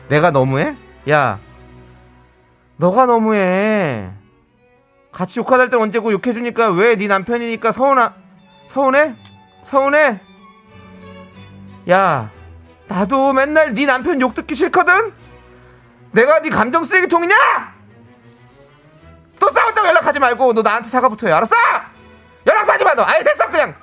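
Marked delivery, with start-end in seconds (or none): 2.8-4.1 slow, drawn-out accusation; reproachful retort. Acted. Friend throwing the accusation back at the wife, reproachful. 5.1-23.7 high-pitched, escalating quarrel voice; angry outburst. Acted. Fed-up friend telling the wife to stay away, angry.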